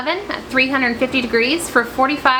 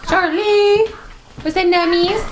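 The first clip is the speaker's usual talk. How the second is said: talking to a dog; 8 semitones higher, 3 dB louder than usual. Real, not acted. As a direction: call the dog sweetly, then coo. Calling the dog, baby talk.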